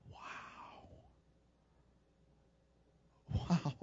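ordinary talk, nothing marked

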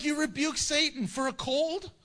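high-pitched incredulous question, rising; imitated disbelieving challenge; mock incredulity. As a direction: question it as plainly absurd. Mocks rebuking Satan over colds.

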